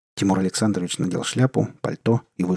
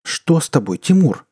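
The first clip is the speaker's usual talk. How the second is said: high, louder gasp of alarm; alarmed wife; 6 semitones higher, 4 dB louder than usual. Acted. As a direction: cry out in alarm at him. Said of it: "всплеснула руками жена".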